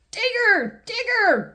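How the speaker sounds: put-on high, excited little boy calling out; excited cry. George spotting the digger, high and excited. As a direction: point at the digger in delight. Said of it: "cries George".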